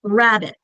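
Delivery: RABBIT with a strong RAB, short falling end; stress on first syllable. Shown as a demonstration. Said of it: "The first one".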